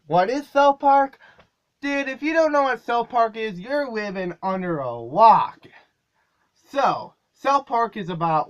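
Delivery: hammed-up hype for the show; cartoon-host energy. Shown as a demonstration. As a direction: pitch the show with big energy. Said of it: "let's try a little bit more energy".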